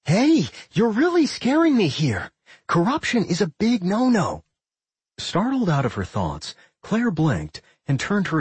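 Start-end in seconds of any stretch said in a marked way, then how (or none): 0.1-4.4 alarmed. A narrator's reading. Doc alarmed.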